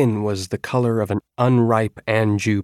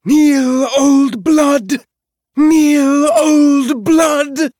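high, loud chanting shout; 10 dB louder, much slower than usual. A narrator's reading. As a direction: scream the chant at the prisoners.

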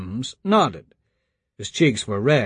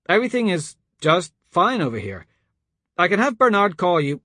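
higher, insistent reassurance; strained Lucas; 6 semitones higher than usual. A narrator's reading. Lucas bluffs the caller that all is fine, strained. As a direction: cover up with a raised voice.

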